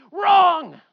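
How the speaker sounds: sudden loud, high shout; emphatic rebuttal. Real, not acted. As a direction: blast the word out to demolish the idea. Emphatic rebuttal of harp idea.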